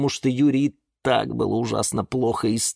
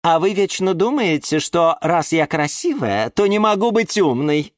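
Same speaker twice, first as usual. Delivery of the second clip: preen with playful reproach; coquettish; 10 semitones higher, 4 dB louder than usual. A narrator's reading. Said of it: "кокетливо хихикнула орхидея". (кокетливо, протяжно)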